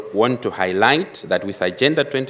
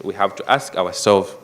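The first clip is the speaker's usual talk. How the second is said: ordinary delivery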